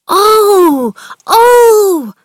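high, loud overjoyed cries of Oh; excited cry. Acted. Colin hearing Dickon will visit, crying out high and loud. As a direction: burst out with joy like a sick boy hearing good news. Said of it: "Colin cried out, excited".

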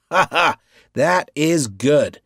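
loud, slow forced laughter; hollow put-on laugh, too loud. A narrator's reading. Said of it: "with too loud a laugh".

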